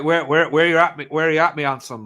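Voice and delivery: imitating a Cornish local's greeting; Cornish accent. Imitating Cornish locals to friends, broad Cornish accent. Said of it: "stuff like that, people saying that".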